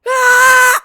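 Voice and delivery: sudden high, loud scream; full-voiced shriek of pain. Kid seeing the blood as pain hits, screaming. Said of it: "I start fucking screaming".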